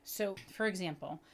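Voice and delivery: normal talking voice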